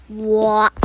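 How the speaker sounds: a startled shout of what; loud stunned outburst; shocked loud what. Acted. Shocked reply to curse.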